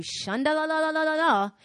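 loud, high rattled-off syllables; mock speaking in tongues. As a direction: imitate an outburst of tongues in prayer. Imitating loud tongues at prayer meeting.

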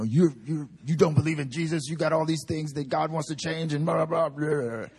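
preachy scolding tone, mocked. Mocking how preachers scold unbelievers, preachy mock lecture. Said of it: "we weren't saying".

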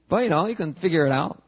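ordinary talk, nothing marked

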